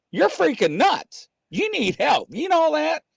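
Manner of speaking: high-pitched mock scolding; retold telling-off. Lady telling off a man talking to a spirit, mock scolding. Retold quarrel in waiting room.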